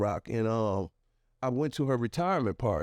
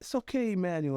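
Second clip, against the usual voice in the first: reassuring teacher; 6 semitones higher than usual. Retold or imitated. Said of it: "she was like".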